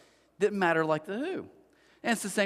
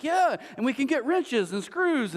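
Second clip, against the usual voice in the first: higher, drawn-out mock enthusiasm; fake excitement; 7 semitones higher, slower than usual. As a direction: play along with forced excitement, slowly overdone. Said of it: "trying to fake excitement".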